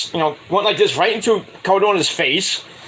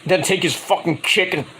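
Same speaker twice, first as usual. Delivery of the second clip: ordinary talk, nothing marked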